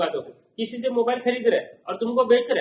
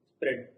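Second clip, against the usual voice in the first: quiet, lower echo of the term spread; term repeated for class; 10 semitones lower, 8 dB quieter than usual. Shown as a demonstration. Teacher drills the class on the term, repeating it low. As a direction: say spread softly and low as an answer. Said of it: "क्या बोलते हैं?".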